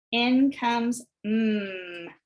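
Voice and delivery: "mm" ditahan sangat pelan dan panjang; stretched sound. Shown as a demonstration. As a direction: hum out the "mm" slowly. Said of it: "Out goes p".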